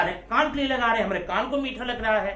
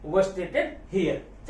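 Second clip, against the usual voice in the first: very slow, word by word; slow dictation; 2 semitones lower, 4 dB quieter, much slower than usual. Shown as a demonstration. Teacher telling students what to write, very slow. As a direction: dictate it twice for the notebook. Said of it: "आप लिख कर क्या आएंगे?".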